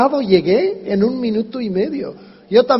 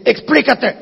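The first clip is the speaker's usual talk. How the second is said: curt demand; 5 semitones higher than usual. Shown as a demonstration. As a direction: snap it like a demand. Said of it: "y no como que". (orden seca y brusca)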